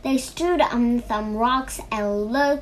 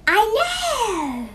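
high, excited exclamation; sudden bright idea; 10 semitones higher, 4 dB louder than usual. A narrator's reading. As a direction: pitch it up as the idea strikes. Snake suddenly has an idea.

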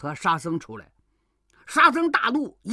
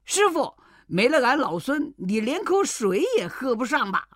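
cheeky monkey; 6 semitones higher than usual. A narrator's reading. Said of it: "行者叫道".

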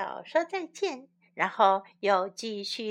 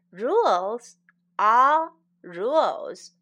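slow, louder and insistent; a strict rule-keeper, slow and loud; firm emphasis; 6 dB louder, slower than usual. Shown as a demonstration. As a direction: lay down the rule slowly and firmly. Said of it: "teacher drills the phrase".

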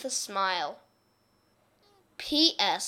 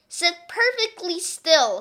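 stern teacher; 7 semitones higher, 6 dB louder than usual. Acted. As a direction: spell out each word like a strict teacher. Miss Swamp commands the class.

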